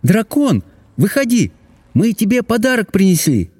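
high-pitched princess calling the dragon out. A narrator's reading. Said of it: "сказала принцесса и позвала".

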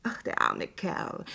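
slow, breathy murmur of pity; slow sighing pity for a dead acquaintance. Acted. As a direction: sigh it out for the poor man. Woman pities the dead driver.

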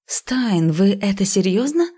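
usual speaking voice

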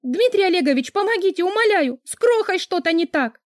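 high, loud, urgent begging; panicked plea. Acted. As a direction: beg desperately for help. Frantic plea for help.

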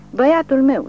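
high, loud anguished cry; desperate scream. A narrator's reading. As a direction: scream for her lost boy. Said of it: "țipă ea desnădăjduită". (țipăt disperat de mamă, foarte înalt și tare)

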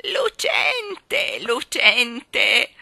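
put-on high loud old woman's cry; excited cry. Balloon woman cries out over the shiny coin, high and loud. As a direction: shriek with delight at the shiny coin. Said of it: "ella gridò".

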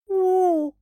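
long pained moan; groan, high and loud; hungover groan. Acted. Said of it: "простонала девушка".

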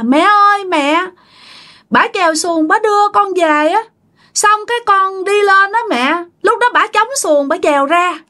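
put-on high-pitched possessed child voice; Tí's child spirit. Drowned child recounting the boat ride to mother, child voice. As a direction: tell mother in a little child's voice. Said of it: "chú Tí này á, nhập vô xác".

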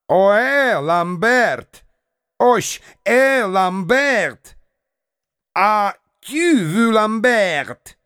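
high, slow mocking roar; barked. A narrator's reading. Andreev leaving, roaring a mocking goodbye at Lambert. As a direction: bellow the farewell while walking off. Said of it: "рявкнул он в последний раз".

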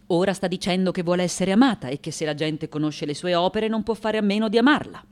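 fast, run-on relaying; hurried interpreter's report; brisk translation. Acted. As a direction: sum it up quickly as interpreter. Interpreter summarizes confession.

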